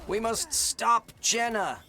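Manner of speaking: slow, loud heroic declaration; heroic shout. Pretend. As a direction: proclaim it like a hero.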